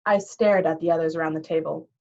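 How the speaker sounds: ordinary delivery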